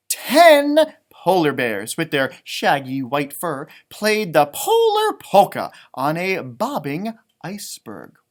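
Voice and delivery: slow, clear picture book reading; storybook read-aloud voice; reading to children. Acted. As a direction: read it out slowly for little listeners. Reads book text to children.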